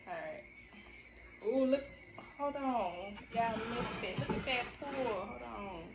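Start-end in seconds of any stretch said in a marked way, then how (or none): none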